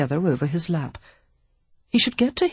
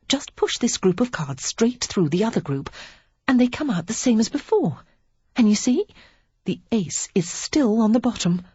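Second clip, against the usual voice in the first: higher, confiding, slipping into a near whisper; Lily's voice; 6 semitones higher than usual. A narrator's reading. Lily teaching Henry a trick, confiding and hushed. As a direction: let the secret drop almost to a whisper.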